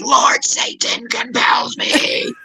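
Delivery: slow, high, breathy scream of a metal growl; mock metal scream. Speaker scaring a screaming Karen with a mock metal scream. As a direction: scream it like a metal singer to scare her off. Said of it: "I start doing a metal scream".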